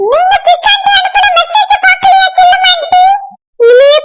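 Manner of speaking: high, urgent calling voice, pitched well up; excited rushing neighbour. Acted. Neighbour urging the auntie to come see, high and urgent. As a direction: hurry everyone along, breathless with news.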